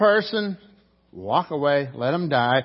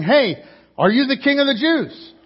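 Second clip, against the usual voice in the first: loud jeering question, pitched up; mocking crowd shout; 8 semitones higher, 7 dB louder than usual. Preacher voicing the mocking crowd at Jesus, loud jeer.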